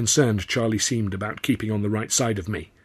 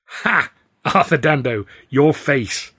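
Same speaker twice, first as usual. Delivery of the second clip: higher, louder gleeful crow; triumphant; 5 semitones higher, 7 dB louder, slower than usual. Acted. Tilly gloating after unmasking herself to Arthur.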